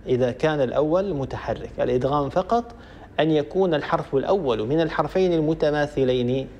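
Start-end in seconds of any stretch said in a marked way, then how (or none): none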